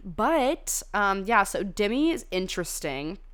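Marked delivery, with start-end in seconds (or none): none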